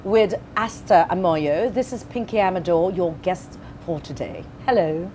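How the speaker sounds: slow, put-on British accent greeting. Shown as a demonstration. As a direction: greet viewers in polished British English. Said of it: "ah, British accent". (mabagal na pagbati sa puntong British)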